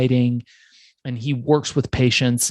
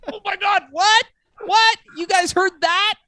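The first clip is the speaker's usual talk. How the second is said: much higher, squeaky mock astonishment; shocked disbelief. Host reliving hearing the producer mention his prank calls, mock shocked squeal. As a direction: replay your own stunned reaction, pitched way up. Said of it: "And I was like".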